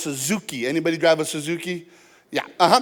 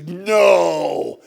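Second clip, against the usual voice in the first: mock disbelief; 4 dB louder than usual. Seller's shocked disbelief, retold.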